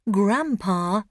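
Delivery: plain reading voice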